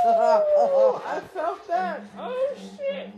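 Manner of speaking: high, slow words through laughter; after laughing hard. Real, not acted. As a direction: gasp the words out mid-laugh.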